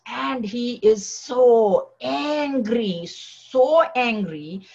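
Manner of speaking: slow, drawn-out stress on "so", twice; stretched-out so angry. Acted. Teacher telling students of Narasimha's fury, slow and stressed. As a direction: lean hard on "so", drawing each word out.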